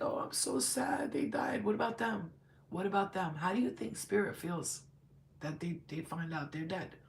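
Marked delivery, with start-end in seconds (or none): 0.0-3.4 mock self-pitying lament. Mimics self-absorbed mourners.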